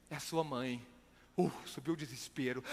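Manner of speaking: ominous hush. Retold or imitated. Grave news by phone.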